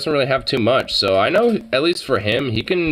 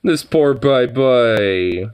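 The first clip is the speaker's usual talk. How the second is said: pitying coo; 4 semitones lower, much slower than usual. Real, not acted. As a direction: coo over the sad kitty. Pitying scratched cat in photo.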